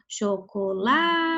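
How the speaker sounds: chocolate slowly, LA held long before TE; stress on LA in chocolate. Shown as a demonstration. Teacher showing the class the strongest syllable, chocolate with a long LA. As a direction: draw out the LA of chocolate. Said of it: "Você vai ver a sílaba mais forte".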